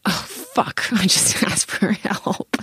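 unvoiced laughing gasp of dismay; a half-laughed oh no. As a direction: breathe it out through a laugh. Panic on asking for help.

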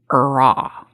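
a hint of a squawking dog; imitating dog's squawk. Imitating the family dog greeting people at home, mild mock squawk. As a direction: bark out short mock squawks. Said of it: "she started screaming".

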